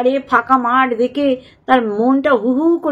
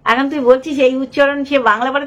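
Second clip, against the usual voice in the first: ordinary delivery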